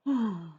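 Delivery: long weary sigh; heavy sigh. Acted. Sarah giving in to her father, weary sigh. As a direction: sigh like a sulky child.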